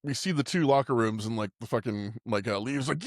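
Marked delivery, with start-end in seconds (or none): none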